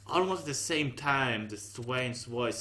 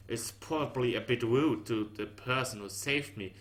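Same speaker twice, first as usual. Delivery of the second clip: plain reading voice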